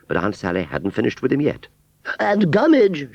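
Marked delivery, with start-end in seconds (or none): 2.2-3.1 high, loud, haughty call; lofty. A narrator's reading. Aunt Sally loftily calling back the scarecrow, high haughty voice. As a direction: summon him from on high. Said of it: "she said loftily".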